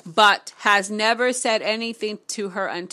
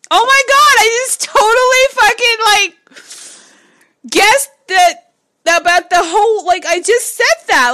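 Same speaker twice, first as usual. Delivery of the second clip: much higher and louder, shrieking with disbelief; excited freakout; 12 semitones higher, 11 dB louder than usual. Real, not acted. Reader shrieking after the book echoes her own words. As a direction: squeal in shocked delight. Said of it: "What the fuck".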